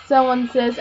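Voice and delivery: ordinary delivery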